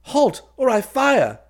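loud, high, drawn-out shout; high, slow, ringing call to halt; shouted challenge. A narrator's reading. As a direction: shout a warning at the top of your voice. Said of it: "He cried".

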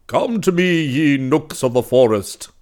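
high, loud summons to the forest; calling out. Acted. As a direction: call out to the forest spirits. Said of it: "he cried".

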